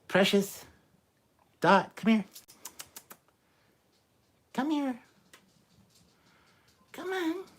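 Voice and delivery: high sing-song dog call. Real, not acted. Calling the pet dog over to the camera, high coaxing call.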